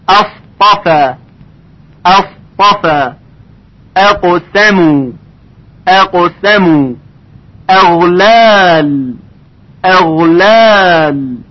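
each word drawn out with a light opening hamza; slow Quran recitation. Shown as a demonstration. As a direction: recite slowly, hamza thin. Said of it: "ترقیقش کاملاً رعایت بشه".

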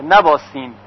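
usual speaking voice

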